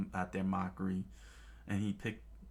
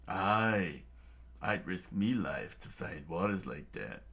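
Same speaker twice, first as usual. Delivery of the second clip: lightly voiced pirate cook. Pirate-ish chef.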